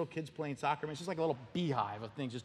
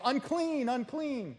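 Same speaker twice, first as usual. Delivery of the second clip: high called-out warning cry; leper's cry; 9 semitones higher, slower than usual. Retold or imitated. Leper warning travellers off with a high cry. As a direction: call out the warning to passersby. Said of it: "you had to yell".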